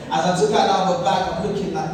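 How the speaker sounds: ordinary delivery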